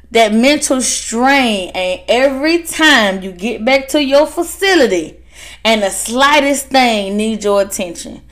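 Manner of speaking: usual speaking voice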